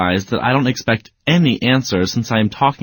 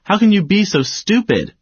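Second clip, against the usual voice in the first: much higher, exasperated yell; yelling at dog; 8 semitones higher than usual. Shown as a demonstration. Owner yelling a rhetorical question at the dog. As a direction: throw the question at the dog, wanting no answer. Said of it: "another way of yelling at your dog".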